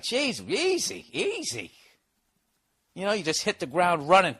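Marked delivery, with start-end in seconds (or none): 0.0-1.7 drawn-out, high, falling mock-dad plea; slow him down with stretched-out words; startled dad calming him. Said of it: "And he's just like".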